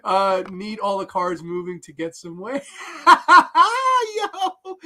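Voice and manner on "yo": high, loud laughing cry; cracking up. Real, not acted. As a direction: burst out laughing into the word. Cracking up at a comment.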